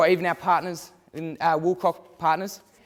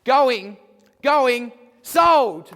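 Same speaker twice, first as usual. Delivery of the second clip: louder and higher, climbing to the hammer; going going sold; 7 semitones higher, 7 dB louder, much slower than usual. Acted. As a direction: build and slam it down on sold. Closing the auction sale.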